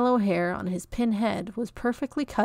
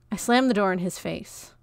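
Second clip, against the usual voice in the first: plain reading voice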